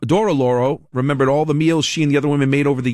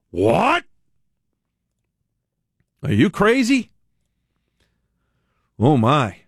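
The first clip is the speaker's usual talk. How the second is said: mock indignation; 3 semitones higher, much slower than usual. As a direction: act affronted, pitch jumping up in disbelief. Mock outrage at insult to cooks.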